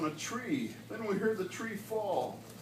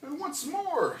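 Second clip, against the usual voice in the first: Lorax snapping; 7 semitones higher, slower than usual. A narrator's reading. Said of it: "snapped the Lorax".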